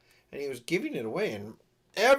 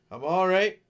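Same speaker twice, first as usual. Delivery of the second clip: louder reassurance; 5 semitones higher, 7 dB louder than usual. Real, not acted. Repeating reassurance while untangling.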